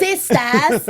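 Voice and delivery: put-on playful Haitian accent that slips toward British; attempted Haitian accent. Co-host helps out with the newcomer's catchphrase, rough mock Haitian accent. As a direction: have a go at a Haitian accent, badly. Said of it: "That was kinda British".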